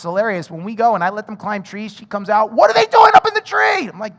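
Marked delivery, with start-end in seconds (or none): 2.5-3.9 high, loud startled question, mock panic; alarmed wife. Wife spotting the kids up a tree, mock alarm. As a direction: gasp it out as a frightened mother.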